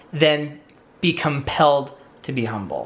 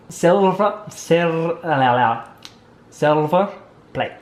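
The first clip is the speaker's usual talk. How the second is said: put-on halting try at a Scottish accent; tentative mock-Scottish; attempted Scottish accent; slower than usual. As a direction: test out a Scottish accent word by word. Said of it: "best impression of a Scottish accent".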